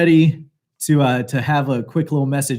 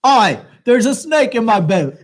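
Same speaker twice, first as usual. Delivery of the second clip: higher, hammed-up fake Jamaican; bad Jamaican accent; 6 semitones higher than usual. Shown as a demonstration. Host taking his last turn, bad Jamaican accent. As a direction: throw yourself into a terrible Jamaican accent. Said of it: "each bad Jamaican accent we do".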